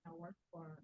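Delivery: faint, far-off mic voice; speaking far from the microphone; barely audible. Real, not acted. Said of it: "Your voice is very low".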